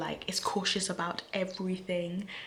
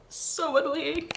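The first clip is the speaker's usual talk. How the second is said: slow high drawn-out whine; mock-exasperated groan; 9 semitones higher, slower than usual. Real, not acted. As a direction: whine it in frustration. Exasperated about Gandalf.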